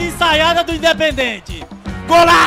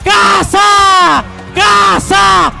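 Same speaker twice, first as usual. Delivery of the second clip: screaming goal call at full power; explosive goal commentary; 10 semitones higher, 10 dB louder, slower than usual. Real, not acted. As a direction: erupt into a long ecstatic goal shout. Goal scored, commentator erupts.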